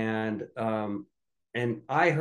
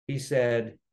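ordinary delivery

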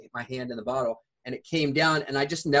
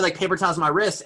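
ordinary talk, nothing marked

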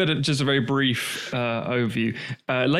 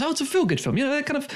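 mock chirpy; 12 semitones higher, faster than usual.

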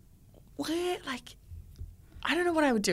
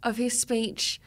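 ordinary talk

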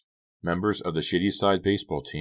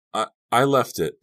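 stammered, higher and louder; halting confession; 7 semitones higher, 8 dB louder than usual. A narrator's reading. Girl confessing about the gun, stammering in distress. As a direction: struggle to get the words out. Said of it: "Della managed to say".

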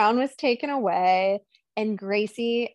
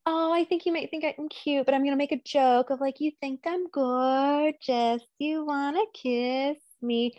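higher, playful, teasing tone; flirty teasing; 5 semitones higher than usual. Retold or imitated. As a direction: tease him like you know he likes you. Gracie flirts with Agent Matthews.

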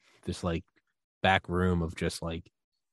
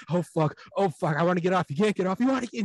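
mock roller coaster panic; 8 semitones higher than usual. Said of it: "then you're just laughing and screaming".

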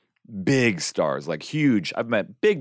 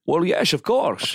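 slow, higher Scottish-accented impression; Sean Connery; 8 semitones higher, slower than usual. Shown as a demonstration. Showing off a requested impression, slow Scottish accent. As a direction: draw it out in mock Scottish. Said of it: "Do you do a Connery?".

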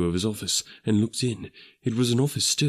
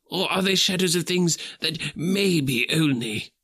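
put-on higher old man's voice asking anxiously; Scrooge, fearful; 5 semitones higher than usual. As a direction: dread creeping in as he asks. Scrooge questions the ghost.

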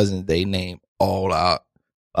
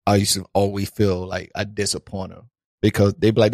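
normal talking voice

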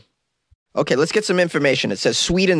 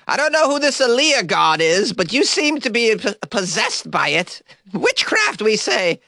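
high, theatrical mock inquisitor; witch accuser; 10 semitones higher than usual. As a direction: build to a squeaky cry of accusation. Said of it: "they were gonna say you were a witch".

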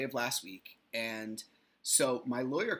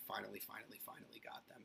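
whispered relief; 4 semitones lower, 17 dB quieter, faster than usual. Real, not acted. Relief at last getting kids.

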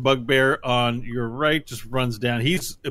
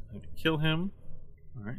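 ordinary delivery

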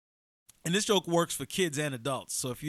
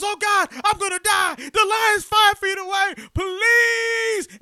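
very high, loud mock death panic, last word drawn out; terrified screaming; 12 dB louder, slower than usual. Man with the lion feet away begs, comic screaming terror. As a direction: wail for mercy in hammed-up terror. Joke man about to die.

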